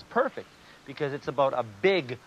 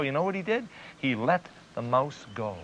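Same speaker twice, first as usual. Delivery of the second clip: plain reading voice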